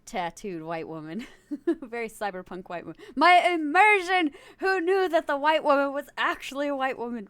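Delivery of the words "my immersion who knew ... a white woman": high, loud hammed-up dismay; mock outrage. Streamer playing a shocked viewer, mock outrage. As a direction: wail it as a stretched, mock-shocked complaint.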